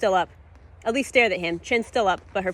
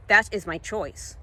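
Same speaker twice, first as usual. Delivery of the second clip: slow defiance; slower than usual. Acted. Elise answers defiantly.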